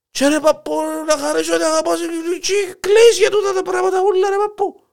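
put-on exasperated young man.

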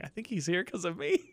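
softly, under the breath, a little worried; quiet worried aside. Retold or imitated. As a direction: murmur it like you just realized you're caught. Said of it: "I'm like".

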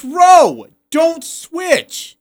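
high, loud pleading order; a coach at his wit's end; exasperated coach. Retold or imitated. Coach yelling at his player, high and exasperated. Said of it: "And then Craig's like".

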